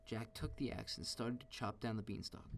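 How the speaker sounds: ordinary delivery, nothing marked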